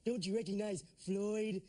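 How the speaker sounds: slow, loud put-on voice; slow, loud impression demanding to be recognized. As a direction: stretch it out loud in a character voice. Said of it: "who are you".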